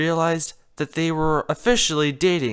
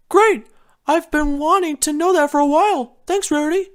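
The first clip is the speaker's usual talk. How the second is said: much higher, louder happy cry; happy Spike; 11 semitones higher, 5 dB louder than usual. Acted. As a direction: burst out glad. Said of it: "Spike said in happiness".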